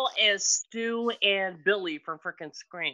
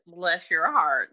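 mock Southern bless your heart; 4 semitones lower, slower than usual. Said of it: "the most Southern way to say F you".

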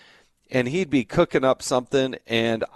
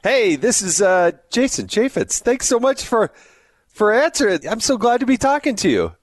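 excited greeting; 10 semitones higher, 4 dB louder than usual. Real, not acted. Host calling a friend on air, excited high greeting. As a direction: greet him like you're thrilled he picked up.

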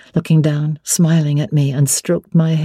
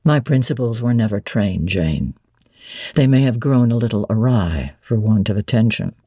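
voiced lower as the brooding master; Mr. Rochester; 6 semitones lower than usual. A narrator's reading. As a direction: drop low and weighty, wary of her question.

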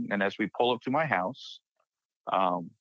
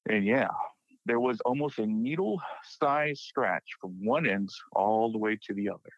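ordinary delivery

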